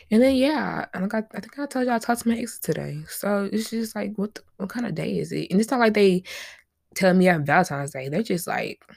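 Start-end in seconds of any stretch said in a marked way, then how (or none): none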